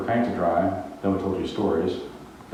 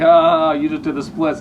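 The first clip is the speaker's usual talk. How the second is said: loud higher mock cheer; 5 semitones higher, 5 dB louder, slower than usual. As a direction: crowd teasing the idiot. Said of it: "they're all just laughing, like".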